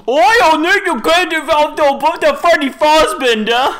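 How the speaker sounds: high, loud, slow hyped YouTuber voice; over-the-top gamer YouTuber shout. Pretend. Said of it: "Do I sound like them?".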